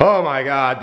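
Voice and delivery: normal talking voice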